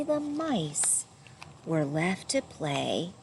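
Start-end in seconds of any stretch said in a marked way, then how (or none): none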